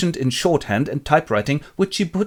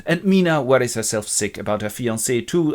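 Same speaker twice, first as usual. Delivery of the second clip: usual speaking voice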